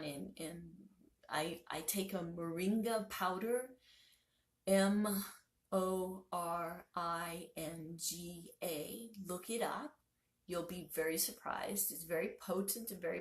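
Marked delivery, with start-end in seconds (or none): 4.7-9.1 slow, letter-by-letter spelling; deliberate spelling, one letter at a time. Real, not acted. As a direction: spell out each letter separately. Spells out the powder's name.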